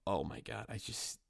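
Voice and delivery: ordinary talk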